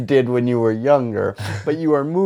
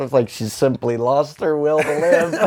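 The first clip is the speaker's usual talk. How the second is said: laughing; 4 semitones higher than usual. Real, not acted. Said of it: "just think back to the fucking Star Wars prequels".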